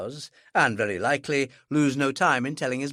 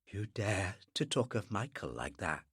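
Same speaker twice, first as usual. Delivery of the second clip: soft, cold and dangerous; very quiet; 6 semitones lower, 11 dB quieter than usual. Acted. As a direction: drop to a menacing hush. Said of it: "Her voice had gone very quiet".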